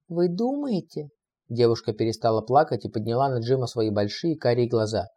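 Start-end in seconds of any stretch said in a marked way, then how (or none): 0.1-1.1 put-on coy girl voice. Girl's reply in her voice.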